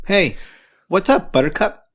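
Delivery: high, loud cheery hail; loud high greeting. Acted. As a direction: call out bright and loud. Coach greets loudly.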